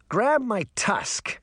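put-on boy voice. A narrator's reading. Puck voiced in dialogue.

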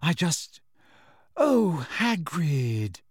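falter, then sigh the name in dismay. A narrator's reading. Hermione sees Hagrid's injured face, dismayed.

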